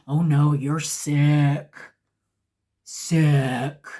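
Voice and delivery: loud, slow, low mock diagnosis; emphatic counselor verdict. Counselor rejects once-a-week treatment, loud drawn-out verdict. Counselor pronounces her sick, acted out.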